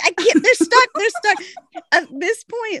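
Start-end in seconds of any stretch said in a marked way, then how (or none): 0.0-1.4 high, whiny, stuck and desperate; pitched up, louder panic; retold panic. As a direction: squeak it out in mock distress. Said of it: "I'm like".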